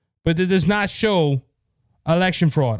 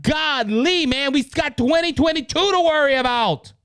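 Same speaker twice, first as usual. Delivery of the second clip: worked-up retelling; 8 semitones higher than usual. Retold or imitated. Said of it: "And I'm like".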